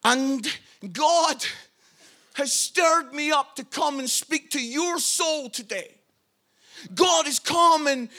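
normal talking voice

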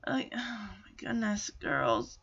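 hushed aghast whisper; oh my goodness, whispered in dismay. Real, not acted. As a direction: breathe it out in shock. Aghast reaction to the girls' plan.

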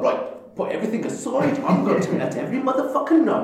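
fast, high, fired-up rant; pissed-off rant. Retold or imitated. Angry customer vowing to trash the venue online, fast heated rant. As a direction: rattle it off like you're fuming. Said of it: "If you're pissed off".

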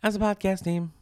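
playful high echo of As a podcast team. Shown as a demonstration. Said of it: "where are you going with that?".

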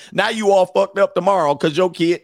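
usual speaking voice

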